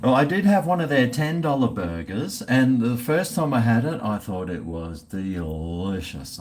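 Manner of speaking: usual speaking voice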